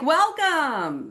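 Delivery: high, drawn-out greeting; welcoming cheer. Retold or imitated. Meeting group welcomes any newcomer, high and drawn-out cheer. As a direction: sing out a big, swooping welcome.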